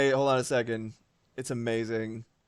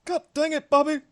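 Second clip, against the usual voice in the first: high-pitched exasperated cartoon-dad cry; Hank Hill; 11 semitones higher than usual. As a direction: scold the kid way up in pitch. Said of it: "more Hank Hill quotes".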